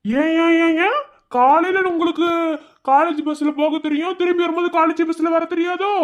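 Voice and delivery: loud, high-pitched sarcastic questioning; mocking protest. Mocking protest at her demand. (நக்கலான எதிர்ப்பு)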